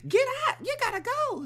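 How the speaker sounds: high, loud shouting; frantic yell. Retold or imitated. Mother shoving the boyfriend out, high frantic yelling. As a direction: shout him out the door in a panic.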